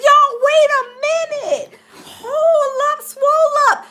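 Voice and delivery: high, excited, stop-everything alarm; startled double-take. Real, not acted. Streamer halting viewers on spotting fake-looking gear, high startled excitement. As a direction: call a halt in high-pitched surprise.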